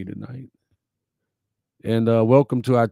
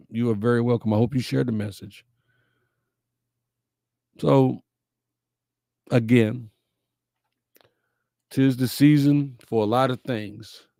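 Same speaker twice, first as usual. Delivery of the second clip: usual speaking voice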